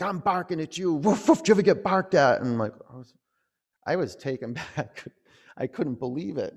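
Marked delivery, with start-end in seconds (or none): 0.0-2.4 high, loud imitation of someone barking; mock outburst, building to loud high woofs; mimicked barking rant. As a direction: bark the words out like a dog. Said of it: "really barking at me like a dog".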